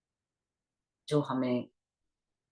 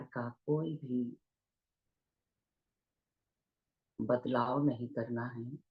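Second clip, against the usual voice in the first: unhurried meditation guidance with long pauses; guided meditation; 3 dB quieter than usual. Real, not acted. Teacher leading online listeners into breath awareness, guided meditation voice. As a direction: guide listeners gently into their breath, leaving long silences.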